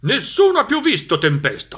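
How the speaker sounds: high, loud dog voice ending in barks; excited dog. A narrator's reading. Dog tells the cat Tempesta is gone, barking loudly. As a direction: proclaim it as a happy barking dog. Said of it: "rispose Cane".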